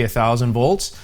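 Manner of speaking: normal talking voice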